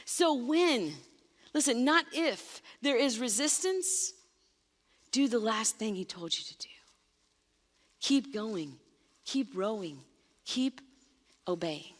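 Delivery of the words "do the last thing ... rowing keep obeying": a near whisper, lower and softer, each keep laid down gently; soft urgent encouragement to persevere; hushed urging. Real, not acted. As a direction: lean in and press it softly. Urging listeners to keep obeying.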